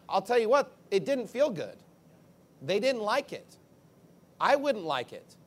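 ordinary talk, nothing marked

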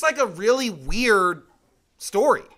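normal talking voice

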